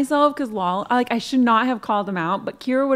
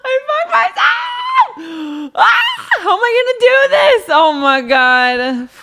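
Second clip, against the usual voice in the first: loud, high self-scolding yell; frustrated shouting; 9 semitones higher, 6 dB louder, slower than usual. Real, not acted. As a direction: shout at yourself in exasperation. Realizes he blew his cover.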